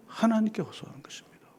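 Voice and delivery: hushed, breathy aside to the congregation; whispered emphasis; sudden whisper. Real, not acted. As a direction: drop to a whisper for weight. Preacher drops to whisper.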